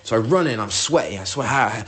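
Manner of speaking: ordinary delivery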